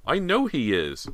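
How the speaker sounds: high, drawn-out, excited insistence; excited shout. A narrator's reading. Boy about to run downstairs, excitedly insisting. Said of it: "James shouted excitedly".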